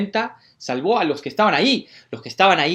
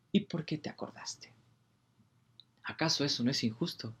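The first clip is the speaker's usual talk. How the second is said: near-whisper, a bit more voiced; soft whisper; 14 dB quieter than usual. Preacher repeating the question to God, softly. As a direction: ask again, still hushed. Whisper continues, slightly louder.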